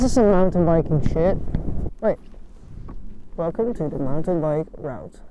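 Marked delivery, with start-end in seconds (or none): none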